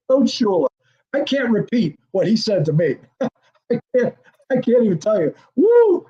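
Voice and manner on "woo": a loud, high, joyful whoop; cheering a huge special-teams hit from the sideline; excited whoop. Retold or imitated. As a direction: whoop like the hit just landed.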